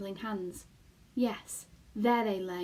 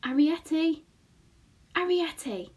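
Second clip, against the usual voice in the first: calls out a name, higher; calling; 7 semitones higher, 6 dB louder than usual. A narrator's reading. Boy calling down the hole for the family, calling out. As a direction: call down into the hole. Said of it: "he called softly".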